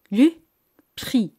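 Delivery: Le prix with a punched Le and a French uvular r in prix; French vocabulary demo. Shown as a demonstration. Teacher models Le prix again for her students, punching Le. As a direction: say Le prix crisply, hitting Le. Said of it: "La palabra el precio".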